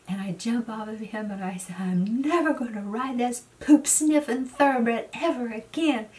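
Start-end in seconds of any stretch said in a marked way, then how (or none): 1.8-6.1 higher, louder mock-furious yell; fed-up rider shouting at her horse; retold angry shout. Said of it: "I was so mad at him".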